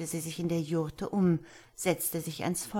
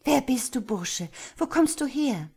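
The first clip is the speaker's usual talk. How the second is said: startled, higher and louder question; überrascht und erschrocken; 7 semitones higher, 5 dB louder than usual. A narrator's reading. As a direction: ask the stranger in sudden alarm. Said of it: "überrascht und erschrocken". (erschrocken und überrascht, höher und lauter)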